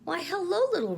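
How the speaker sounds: a bright, high start of surprise at his friend; delighted exclamation. A narrator's reading. Pooh greeting little Roo with delight. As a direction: greet him with glad surprise. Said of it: "he exclaimed".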